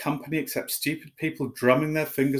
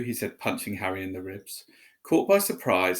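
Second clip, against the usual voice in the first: plain reading voice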